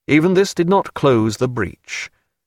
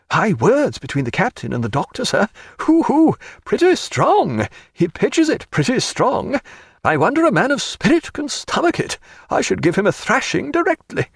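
gossiping Mister Atkins; 11 semitones higher than usual. A narrator's reading. Atkins reports the quarrel to Stanhope, gossipy and indignant. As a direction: gloat over the row, pitched well up.

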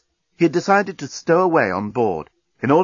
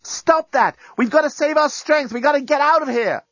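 urgent high-pitched shouting; high, insistent cry from afar; yelling; 3 dB louder than usual. A narrator's reading. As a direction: yell to break up the fight. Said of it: "Lucy was yelling".

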